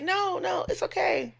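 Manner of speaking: imitating the client's high voice; lightly voiced gentle client; high, light reassurance.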